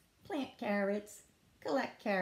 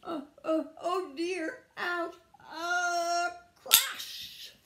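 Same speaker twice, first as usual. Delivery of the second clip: high, loud struggle building to a crash; mounting alarm; 10 semitones higher, 6 dB louder, slower than usual. Acted. Rabbit crams carrots into Tortoise's house, alarm mounting to a crash. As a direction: strain upward, then burst on the crash.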